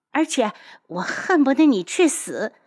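high-pitched hateful outburst; furious, emphatic spite; venomous cursing. Acted. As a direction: hurl a spiteful wish with full feeling. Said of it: "楚景儿骂得尽兴".

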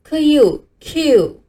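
"K U Q" slowly, parts first, then joined; sounds blended. Shown as a demonstration. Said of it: "把这两个音连起来念".

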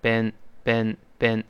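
American been, rhyming with pen; short open vowel. Shown as a demonstration. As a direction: repeat been with a short eh, not ee. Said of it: "那个E E发哎的声音".

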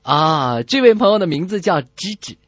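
ordinary talk

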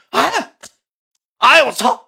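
high startled cry of surprise; startled yelp. Real, not acted. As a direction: yelp as the mask is yanked off. 口罩被揪下吓一跳. (吃惊地高声惊叫)

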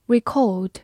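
"record" as British verb, ri-KAWD; British verb pronunciation. Shown as a demonstration. Teacher models the verb reading for learners, British accent. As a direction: say "record" the British way, stress second, no R. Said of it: "或者读作record".

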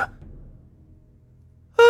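sudden cry; a sharp startled scream; hit hard crying out. A narrator's reading. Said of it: "女孩突然大叫一声". (短促的惊叫)

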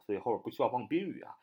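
ordinary delivery

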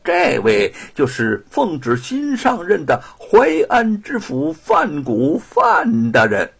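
high, loud, slow fanfare; toadying, drawn-out presentation; fawning introduction. A narrator's reading. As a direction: announce him obsequiously, stretching the titles. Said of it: "谄媚地介绍".

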